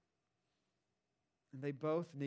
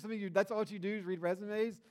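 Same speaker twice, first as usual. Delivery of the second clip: ordinary talk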